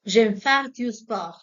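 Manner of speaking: slow, careful and loud, like a teacher modelling; slow classroom demo; slow model phrase. Shown as a demonstration. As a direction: spell it out slowly for the class. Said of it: "teacher models French phrase".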